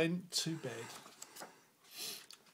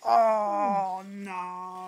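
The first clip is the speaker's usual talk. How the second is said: exasperated dad; 2 semitones higher, 5 dB louder than usual. Acted. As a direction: wail it like a worn-out father. Dad's refrain retold to child.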